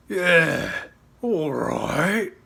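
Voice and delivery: a big beast agreeing; dragon voice. Acted. Montjoy the dragon answering his rider, loud creature voice.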